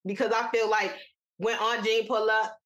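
ordinary talk, nothing marked

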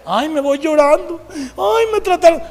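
high, drawn-out mock wailing; mock crying. Preacher mocking oversensitive church members, hammed-up whining. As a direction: whine it like a hammed-up crybaby. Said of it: "¿Cuántos somos grandes chillones?".

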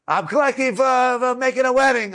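high, pleading collector; collector's plea. Retold or imitated. A collector at the car door begging for wedding money, high plea. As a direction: ask for charity sing-song.

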